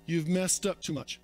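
low, quiet taunting accuser; lightly voiced accuser. Preacher voicing the enemy accusing the congregation, low and quiet. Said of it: "He'll say".

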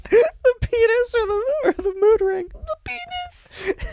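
high, strained squeak, slowed; squeaky high voice. Real, not acted. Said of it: "Why are you talking like".

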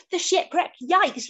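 storybook yelp, pitched high; mock-alarmed yell for a children's tale; Pufferfish. A narrator's reading. Pufferfish spotting the shipwreck, mock-alarmed yell. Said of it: "yelled Pufferfish".

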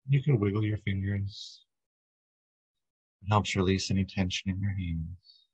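calm, steady body-scan narration, little pitch movement; guided meditation. Real, not acted. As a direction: guide listeners gently into relaxation, unhurried. Leads a guided breathing meditation.

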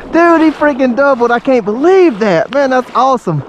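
high, elated gushing; thrilled disbelief. Real, not acted. Father celebrates child's double.

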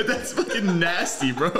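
laughing. Real, not acted. Laughing disgust at joke.

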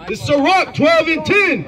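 high, slow scripture reading; scripture declamation. Real, not acted. Reader proclaiming scripture at an opponent in debate, declamatory. Reader announces verse when told to reread.

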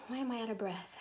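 ordinary talk

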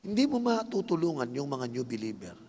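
usual speaking voice

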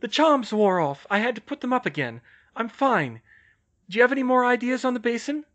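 high, loud, flustered woman's voice; high female character voice, reassuring in a fluster; frantic Hermione. A narrator's reading. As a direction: pitch it high and projected, flustered. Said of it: "she said frantically".

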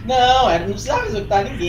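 scolding. Real, not acted. Scolding teammate.

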